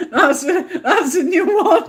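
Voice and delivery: laughing through the words; laughing fit. Real, not acted. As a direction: talk while bursting into real laughter. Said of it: "You've got me going now".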